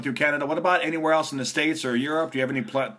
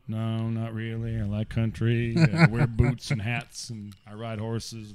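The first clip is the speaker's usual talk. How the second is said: mock country drawl; 3 semitones lower, slower than usual. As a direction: drag the words out like a cowboy. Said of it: "talks like this".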